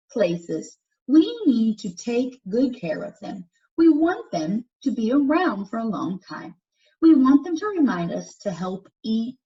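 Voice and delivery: put-on clear, lively read-aloud for young listeners; reading to children. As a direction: read it out to the class like a picture book. Reads picture book to class.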